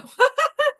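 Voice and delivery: short high giggle; a quick high laugh. Real, not acted. Laughs after going to bed.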